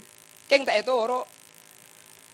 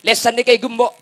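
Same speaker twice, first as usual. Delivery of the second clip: raised, loud sermon voice; emphatic preaching; 8 semitones higher, 10 dB louder than usual. Shown as a demonstration. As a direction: hammer it home louder and higher. Repeated loudly for the congregation.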